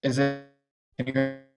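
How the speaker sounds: breaking up. Real, not acted. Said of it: "No, it's still breaking up".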